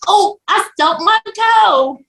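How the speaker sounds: put-on high, drawn-out little-kid cry; toddler grandbaby. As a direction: imitate a two-year-old, pitched high and slow. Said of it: "my two and a half year old grandbaby".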